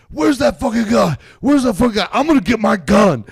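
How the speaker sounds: high, loud mock yell. Retelling how he yelled for his friend at dawn, loud mock yell. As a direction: shout it like you've lost it, half-laughing. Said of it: "And I'm yelling".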